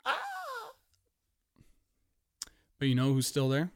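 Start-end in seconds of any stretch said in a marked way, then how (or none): 0.0-0.7 very high, soft squeal; small falsetto "ah"; reaction noise. Retold or imitated. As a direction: let out a thin, quiet squeak of dismay. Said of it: "I go".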